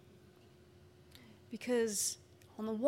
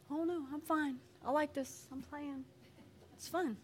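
soft, higher, offhand refusal; little child; 5 semitones higher, 8 dB quieter than usual. Acted. Child playing on the wall, small evasive voice. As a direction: beg off lightly in a small child's voice. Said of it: "little Terry".

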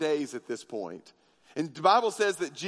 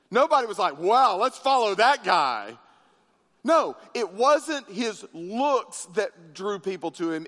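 preaching; 3 semitones higher than usual. Acted. Preacher driving his point.

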